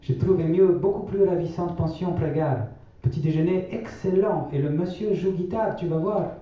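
grand-mère, voix bien plus aiguë et douce. A narrator's reading. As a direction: prendre la voix haute de la vieille grand-mère. (grand-mère qui répète sa maxime aux enfants, voix aiguë)

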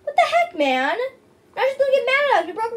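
high, loud exasperated scolding; exasperated yell. Real, not acted. Scolding friend over broken dish.

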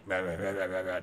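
low, quiet growly superhero mumble; mock his voice, dropped way down in pitch; wordless low imitation of a masked vigilante; Batman. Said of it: "talking in his Batman voice".

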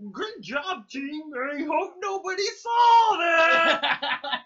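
high, slow, laughing hype voice; Lucio voice line. Retold or imitated. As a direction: crack up while mimicking the hero. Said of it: "that voice line".

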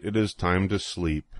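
usual speaking voice

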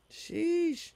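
very high drawn-out "Sheesh". Real, not acted. The friend teasing her about going ham, high "Sheesh". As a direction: squeal it teasingly.

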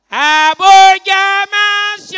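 long, loud hawker-style cry; shouted street call. Acted. Street call at Ramblay at six.

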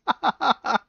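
loud, high burst of laughter. Real, not acted. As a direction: crack up loudly. Laughing after outburst.

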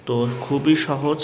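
ordinary talk

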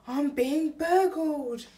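hammed-up shocked burglar; outraged surprise. Bill hears burglar.

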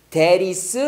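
"That is" run together as one word; linked R sound. Shown as a demonstration. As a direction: tap the T in "That is" like a light R. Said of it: "that is로 발음해요".

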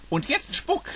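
high, calling out to Birdo; called out. A narrator's reading. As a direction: Max urges Birdo on, a high call. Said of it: "rief er".

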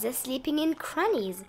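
ordinary delivery, nothing marked

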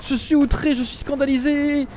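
high, hammed-up indignation; mock outrage. Caught player hams up shock to the chat. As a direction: play the scandalized victim.

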